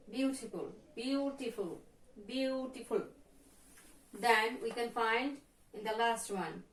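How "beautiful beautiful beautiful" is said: "beautiful" with BEAU held and stressed; stress on first syllable. Shown as a demonstration. Teacher demonstrates "beautiful", first syllable stressed. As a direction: draw out the opening of "beautiful": BEAU-ti-ful. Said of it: "stress in the first syllable".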